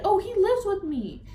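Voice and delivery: high, loud mocking imitation of her; mocking the other girl. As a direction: parrot her in a high sneering voice. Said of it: "this bitch said".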